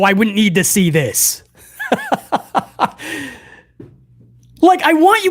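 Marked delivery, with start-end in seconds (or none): 1.8-3.3 high breathy laugh. Real, not acted. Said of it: "laughs at own joke".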